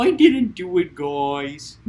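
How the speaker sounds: hint of a guilty dog's plea; voicing the dog. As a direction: play the droopy dog pleading innocence.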